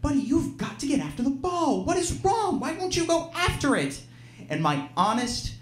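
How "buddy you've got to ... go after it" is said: much higher, pleading, exasperated urging; exasperated dad. Retold or imitated. Dad pulling his son aside at the break, pleading and exasperated. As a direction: beg the kid to just chase the ball. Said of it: "he pulls me aside, and he goes".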